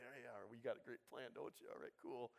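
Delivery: soft, low, casual murmur; low-key agreeing. Acted. Armor bearer ready for the game plan, low-key agreeing. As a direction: nod along under your breath.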